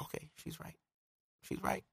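breathy near-silent self-talk, conceding; whispered to himself. Retold or imitated. Man talking himself down after his girlfriend's text, whispered. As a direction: whisper it like you're giving in.

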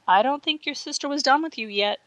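sneering. A narrator's reading. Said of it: "sneered Patricia".

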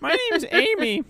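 high, put-on cutesy introduction; mock character introduction. Mimicking the renamed character, laughing.

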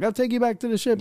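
very high-pitched imitated cabbie; cab driver. Cab driver offering a ride, high mock voice. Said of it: "some guy in a Honda Civic".

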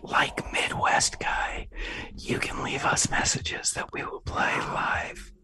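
mock Midwestern, all in a whisper; whispered Midwestern accent. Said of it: "whisper and do a Midwestern accent".

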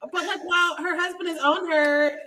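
ordinary talk, nothing marked

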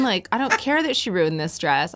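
ordinary talk, nothing marked

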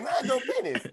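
high mock nag. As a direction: mimic them in a high sing-song whine. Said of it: "That's how they be talking".

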